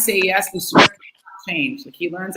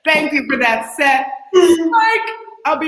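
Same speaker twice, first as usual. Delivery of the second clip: mock shout; 8 semitones higher, 5 dB louder, slower than usual. Shouting a punchline joke.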